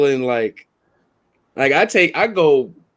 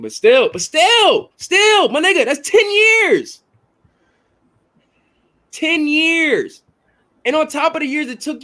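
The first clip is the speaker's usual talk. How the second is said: heated emphatic; 10 semitones higher, slower than usual. Real, not acted. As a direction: hammer it home, pitched way up. Heated debate, high emphatic.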